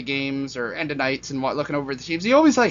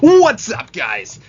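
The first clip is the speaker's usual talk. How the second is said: much higher, much louder hammed-up intro call; hype youtuber intro; 5 semitones higher, 9 dB louder, much slower than usual. Vlogger opening his video with a hammed-up hype greeting. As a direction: shout it like an over-the-top youtuber. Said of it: "Oh no, I'm doing way too much".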